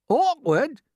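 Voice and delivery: high, loud incredulous yelp; indignant cry. A narrator's reading. Earthworm throws the grasshopper's word back, crying out. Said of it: "cried the earthworm".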